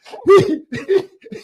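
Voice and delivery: high hearty laugh; delighted burst of laughter; gleeful laugh. Real, not acted. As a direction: crack up with glee.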